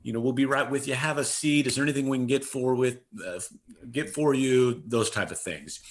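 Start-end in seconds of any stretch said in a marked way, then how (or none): 0.0-4.8 higher-pitched, eager front-desk welcome; enthusiastic greeting. Retold or imitated. Clinic staff welcoming patients as they walk in, enthusiastic. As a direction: greet them like a long lost friend, pitched up. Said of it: "we did it with enthusiasm".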